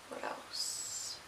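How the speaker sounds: breathy whisper to herself; softly whispered aside; whispered musing. Real, not acted. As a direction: whisper it as you think of the next topic. Musing to herself mid-vlog.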